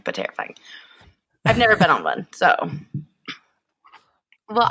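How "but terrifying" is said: whispered. Real, not acted. Aside after motorcycle idea.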